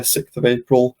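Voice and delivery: normal talking voice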